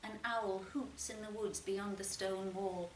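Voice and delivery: plain reading voice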